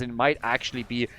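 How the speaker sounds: ordinary delivery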